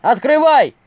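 high, loud bark; barked order. A narrator's reading. Guard outside the door to those inside, barked command. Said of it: "рявкнул голос".

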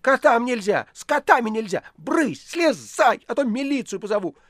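high, loud spiteful shrieking; furious conductress. A narrator's reading. Conductress yelling at the cat climbing into the tram. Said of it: "со злобой, от которой даже тряслась, закричала".

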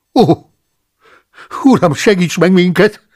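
loud, high cry to the Lord. A narrator's reading. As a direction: call out to heaven in alarm. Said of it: "kiáltott fel". (hangos, magas felkiáltás)

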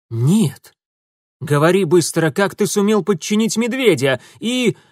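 furious interrogation; angry demand. A narrator's reading. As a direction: order him to talk now. Urusov interrogating captive.

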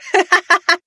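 high, loud mocking laugh; squeaky cackling at the victim; full-throated cackle. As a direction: burst into gleeful villainous guffaws. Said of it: "Я захохотал во всё горло".

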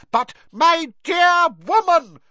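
very high, loud, slow roar; Fudge's roar. A narrator's reading. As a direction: roar in affronted protest, drawing out the words. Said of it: "roared Fudge".

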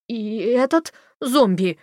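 slow, nervous question; a touch of frightened worry; anxious. A narrator's reading. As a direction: ask it fearfully. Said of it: "тревожно спросил он".

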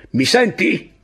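high, loud demand; shouted question. A narrator's reading. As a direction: yell for an answer from a subordinate. Captain threatens with pistol.

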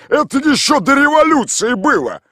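high, loud retort; shouting back. A narrator's reading. Mikhail Ivanovich shouts back at Tolik. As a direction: yell it back dismissively. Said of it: "закричал".

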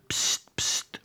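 secretive psst from below a window; whispered attention-getter; hissed "pst". A narrator's reading. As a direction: get someone's attention on the sly.

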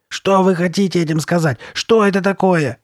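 high, loud, insistent questioning; agitated demand. A narrator's reading. Girl grabbing the shopkeeper's shirt, agitated demand.

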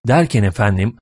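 ordinary delivery

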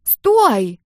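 high, loud drawn shout; urgent high yell. A narrator's reading. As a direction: yell after someone walking away. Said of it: "заорала Нелли".

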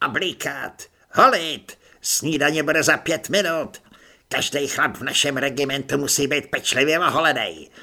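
loud drill-sergeant commands; barked orders. Acted. Corporal Řemendr bawling orders at recruits, loud. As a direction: bark orders at the whole room.

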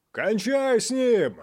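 slow, very high roar. Acted. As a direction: roar it from the crowd. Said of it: "проревел кто-то наверху".

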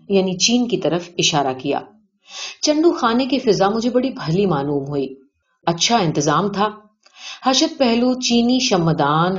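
ordinary delivery

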